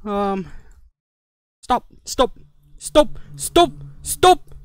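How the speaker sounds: ordinary delivery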